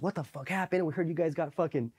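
high-pitched gossiping curiosity; imitated eager questioning. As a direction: act out mates demanding the story. Barracks mates ask eagerly.

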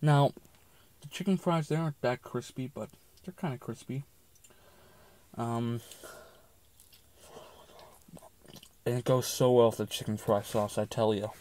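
speaking with food in mouth; eating between words; talking while chewing. Real, not acted. Seen 0: Bites chicken fry before; chews and holds food through later words.

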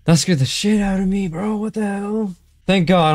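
usual speaking voice

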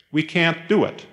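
usual speaking voice

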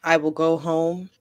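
normal talking voice

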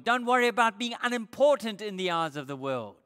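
ordinary talk

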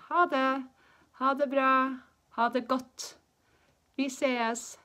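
high, loud goodbyes with clear gaps; language-teacher demonstration. Shown as a demonstration. Teacher showing different ways to say bye, loud demonstration. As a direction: project each farewell for learners to copy.

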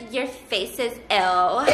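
put-on low, slow teen-girl put-down; Mean Girls-era teen. Friend snapping back at sister, slow mock teen-girl sass. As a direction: imitate a cool girl's dragged-out comeback. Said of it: "This is, like, how everybody talked back then".

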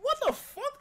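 very high, loud stunned shout; a shriek of total disbelief; shocked. Retold or imitated. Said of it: "I'm like".

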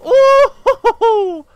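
very loud falsetto ooh-hoo-hoo; gleeful escape whoop; excited high whoop. Real, not acted. Gamer reacts to narrow escape.

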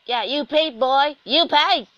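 loud, high, mock-triumphant yell; taunting shout. Player hollers at a game character, taunting shout.

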